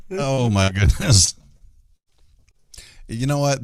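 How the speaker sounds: normal talking voice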